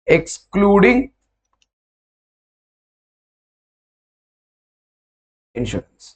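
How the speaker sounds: slow dictation. Shown as a demonstration. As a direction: spell it out slowly for writing, excluding drawn out. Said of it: "Do me a favor when you write this".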